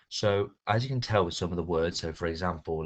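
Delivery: normal talking voice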